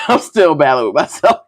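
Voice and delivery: amused, breaking into laughter; laughing. Real, not acted. Vlogger admitting she is torn with herself, laughing. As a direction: say it through a laugh. Laughs at her own struggle.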